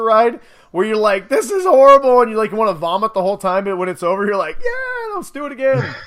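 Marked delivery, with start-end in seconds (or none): 1.3-2.2 loud, high-pitched mock dismay; mock horror. Voicing a viewer on a crazy ride, mock horrified. Acted rider's reaction mid roller coaster. 4.6-5.9 very high, squealed playful excitement; mock giddy cheer. Voicing a rider when the ride is over, giddily excited.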